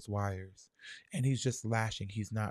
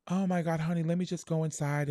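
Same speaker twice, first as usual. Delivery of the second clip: mom's voice; 8 semitones higher than usual. Retold or imitated. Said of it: "My mom was like".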